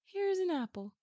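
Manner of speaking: put-on a kind sister offering food to a hungry boy, high and sweet; sweet kind girl. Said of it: "And she's like".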